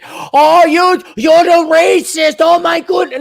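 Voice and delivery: high, loud, slow gasping outrage; hammed-up scandalized critic; mock accuser. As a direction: clutch your pearls, mock outrage. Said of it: "you can't say".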